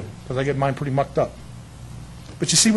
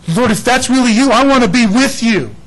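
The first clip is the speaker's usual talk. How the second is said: higher, louder eager call; 8 semitones higher, 10 dB louder than usual. Retold or imitated. Peter calling across water to Jesus, eager. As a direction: call out longing to be near. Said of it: "Peter calling to Jesus".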